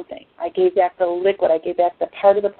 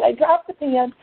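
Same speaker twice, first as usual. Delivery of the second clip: ordinary delivery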